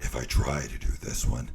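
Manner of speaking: very deep, breath-heavy werewolf growl. Shown as a demonstration. Streamer testing a werewolf voice for viewers, deep and breathy. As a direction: try out a deep werewolf, changing how you breathe. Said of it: "mess with my voice a little bit to get the werewolf".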